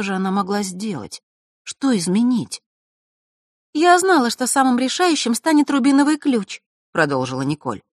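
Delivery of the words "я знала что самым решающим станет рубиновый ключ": put-on higher, sorrowful girl's voice; a sad young girl speaking. A narrator's reading. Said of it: "Столько печали было в голосе Николь".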